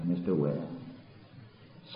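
"Mister Wet Eyes" breathed softly and slowly; hushed tender. Shown as a demonstration. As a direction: drop to a slow near-whisper.

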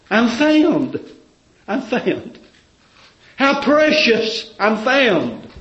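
high, loud, drawn-out preaching cry; preaching at full force. Acted. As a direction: pour it out like a testimony shouted from the pulpit. Preacher voicing a convert's testimony.

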